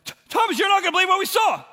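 very high, loud, fast breathless news; excited disciples. Acted. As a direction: blurt it out, bursting to tell. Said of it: "And they're like".

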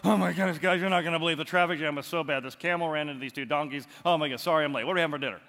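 lightly voiced flustered latecomer; late Thomas. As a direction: hurry in with a mild excuse. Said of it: "you can just imagine coming into the room".

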